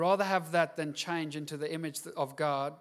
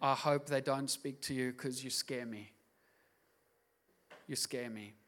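inner thought; 4 semitones lower, 7 dB quieter than usual. Retold or imitated. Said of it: "I meet Christians where I think".

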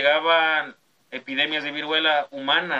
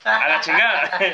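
laughing it off, louder; 6 semitones higher, 5 dB louder than usual. Real, not acted. Laughing at the joke about the gardener's son, louder and bursting. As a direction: blurt it out through a laugh.

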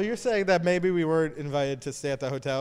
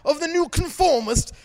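higher, loud shouting British chef rant; Gordon Ramsay impression; 8 semitones higher, 9 dB louder than usual. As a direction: bark it like an angry TV chef. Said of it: "This is totally Gordon Ramsay".

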